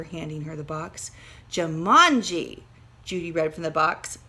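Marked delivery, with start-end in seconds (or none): 1.5-2.6 slow, loud "Jumanji" with falling end; drawn out. A narrator's reading. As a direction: stretch "Jumanji" slowly and loudly. Reading the game's name.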